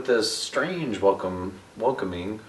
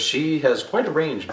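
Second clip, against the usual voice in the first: ordinary talk, nothing marked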